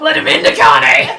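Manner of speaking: loud playful yell; shouting. Pretend. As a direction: call it out across the room. Shouting cartoon line.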